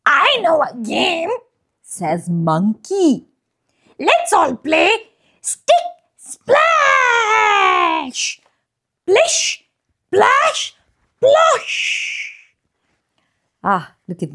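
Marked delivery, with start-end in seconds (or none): 0.0-1.3 high monkey voice. Acted. 4.0-12.3 high, drawn-out, squealing cheer; excited high monkey voice. Acted. Monkey calling game excitedly.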